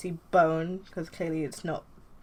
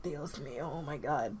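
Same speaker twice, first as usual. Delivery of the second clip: soft groaning lament, lower; dismayed; 3 semitones lower, 9 dB quieter than usual. Acted. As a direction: despair into your hands. Nova covers his face.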